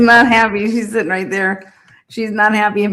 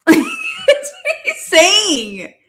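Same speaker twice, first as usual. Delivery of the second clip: laughing, much higher and slow; 11 semitones higher, much slower than usual. Real, not acted. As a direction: let the laugh carry the words. Laughing after mock quote.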